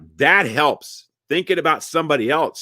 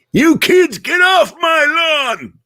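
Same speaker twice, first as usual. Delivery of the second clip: put-on high, loud cranky old-timer shout; hammed-up high-pitched yell, last word stretched; yelling old codger; 7 dB louder, slower than usual. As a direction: holler it like a crotchety neighbor. Mock old-timer yelling at kids.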